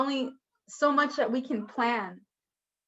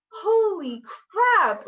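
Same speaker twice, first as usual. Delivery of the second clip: shocked; 7 semitones higher, 4 dB louder, much slower than usual. Real, not acted. Shock once in the water.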